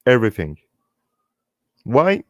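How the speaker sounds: usual speaking voice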